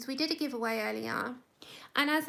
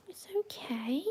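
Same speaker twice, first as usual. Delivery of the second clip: whisper; 3 semitones higher than usual. Real, not acted. Soothing the dog.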